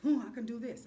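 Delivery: loud, cocky imitation of a man showing off; macho boast. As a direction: puff up like a competitive man. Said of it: "that testosterone that was going".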